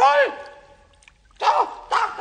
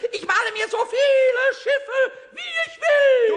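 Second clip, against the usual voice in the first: louder, higher stubborn yell; defiant shout; 7 semitones higher, 6 dB louder than usual. Acted. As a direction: insist on it at the top of your voice. Pumuckl defies Eder.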